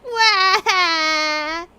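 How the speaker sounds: loud, high fake sob wail; hammed-up crying wail; mock crying wail. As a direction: wail like a dumped TV heroine. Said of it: "someone just broke up, she's like".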